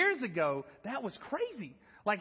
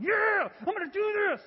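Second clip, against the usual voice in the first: much higher, louder hyped yell; pumped-up shout; 12 semitones higher, 5 dB louder than usual. Retold or imitated. Boy shouts to his friend while chest bumping, pumped up. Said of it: "I'm like".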